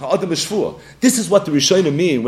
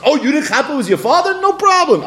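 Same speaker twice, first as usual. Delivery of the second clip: mock breezy dismissal; 9 semitones higher than usual. Mock breezy ruling, laughing.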